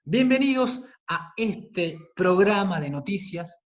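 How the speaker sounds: upbeat, optimistic news-show welcome with a punched opening; bright, happy timbre. Shown as a demonstration. Teacher demonstrates a news programme opening for students, bright and happy. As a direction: welcome listeners brightly, in measured, drawn-out phrases. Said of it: "Nosotros estamos utilizando un timbre brillante, feliz, óptimo".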